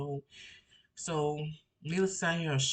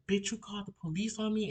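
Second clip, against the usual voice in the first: imitating angry sister; 6 semitones higher than usual. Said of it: "she's like".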